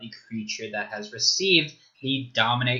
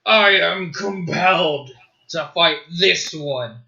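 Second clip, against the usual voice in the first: slow possessed voice; 4 semitones higher, 6 dB louder, slower than usual. Acted. DM voicing possessed NPC.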